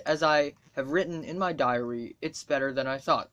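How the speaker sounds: usual speaking voice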